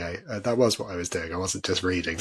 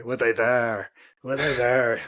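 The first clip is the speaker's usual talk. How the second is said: mock Irish accent, a little raised; strong Irish accent; 2 semitones higher, 4 dB louder than usual. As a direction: imitate an indignant teacher with an Irish accent. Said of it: "in a strong Irish accent".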